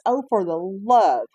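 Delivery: loud, slow "oh, for the love"; doctor fed up with the therapist, a loud drawn-out sigh of words; exasperated. Retold or imitated. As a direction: throw it out in exasperation.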